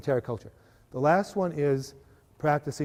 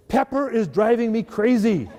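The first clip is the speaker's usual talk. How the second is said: higher, louder exasperated outburst; hammed-up frustrated rant; mock venting; 7 semitones higher, 6 dB louder than usual. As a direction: let off steam into the drawer. Venting alone into a drawer.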